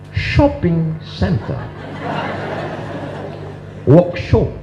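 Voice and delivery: "Shopping center" said slowly with a clear sh; English sh demo. Shown as a demonstration. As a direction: stretch out the sh in "workshop" with a chuckle. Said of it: "Dalam bahasa Inggris adakah itu". (contoh bunyi sy dalam bahasa Inggris, pelan sambil tertawa)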